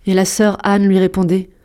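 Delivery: ordinary delivery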